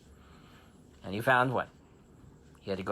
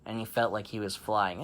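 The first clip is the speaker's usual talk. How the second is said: plain reading voice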